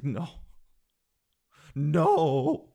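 loud, drawn-out refusal; loud horrified no. Retold or imitated. Postal clerk refusing the return, loud and horrified. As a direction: bark a shocked no at the customer. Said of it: "And she was like".

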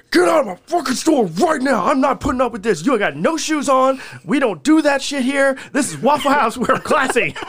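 high, loud re-enacted furious bellow; booming, pissed-off voice. Cook re-enacting throwing drunks out of his diner, booming angry shout. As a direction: boom it out like you're clearing the room. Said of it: "I'm booming. Like, I'm pissed".

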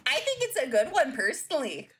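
teasing auntie voice. Acted. Reader taunting the producer in a loud doting aunt voice. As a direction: coo at him like a creepy doting aunt.

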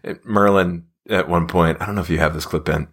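ordinary talk

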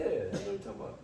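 ordinary talk, nothing marked

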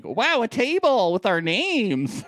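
high, slow mock amazement; stoked and optimistic. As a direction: imitate someone wildly impressed by very little. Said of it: "So stoked and optimistic".

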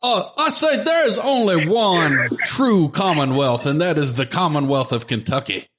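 blustering rooster impression, swooping up high; Foghorn Leghorn. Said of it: "[name] could do Foghorn Leghorn".

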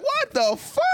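high-pitched mock shock; imitated disbelief. Retelling the audience's reaction to his voice, mock astonished. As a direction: squeal it in amused disbelief. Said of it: "They was like".

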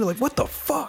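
high, disbelieving what the fuck; shocked outrage, voice jumping up; incredulous. Real, not acted. Outraged at ally betrayal.

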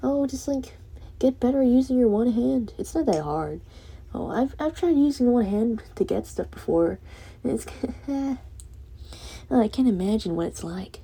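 high, sing-song fake sympathy voice; mocking sympathizers. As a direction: parody a condescending well-wisher. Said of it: "they can go".

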